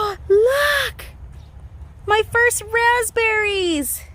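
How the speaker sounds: high, slow thrilled exclaim; excited. Real, not acted. Gardener shows viewers her first raspberries, high thrilled squeal. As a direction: squeal over the first berries. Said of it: "I am so excited".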